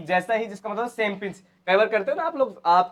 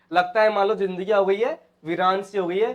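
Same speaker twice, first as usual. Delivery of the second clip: usual speaking voice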